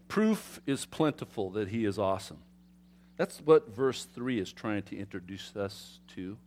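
ordinary talk, nothing marked

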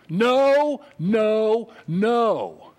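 loud, drawn-out NO, stepping down in pitch each time; forceful, separate no's; emphatic refusal. Acted. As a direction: hammer each "no" as a flat refusal. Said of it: "it's a very emphatic".

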